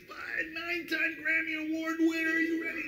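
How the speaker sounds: high, pumped-up announcer bark; hyped club announcer. Retold or imitated. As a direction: hype the crowd like a blues club MC. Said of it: "you're like".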